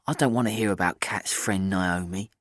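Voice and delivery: ordinary delivery, nothing marked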